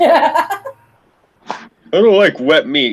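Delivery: high, slow whiny mockery of the nephew's complaint; mocking nephew. Mocking his nephew's refusal of gravy, high and slow whiny. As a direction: drag it out like a fussy kid, mocking. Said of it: "You're a sick fuck".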